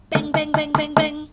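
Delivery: loud, punchy knocks; mock banging. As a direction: thump out each bang. Knocking sound effect.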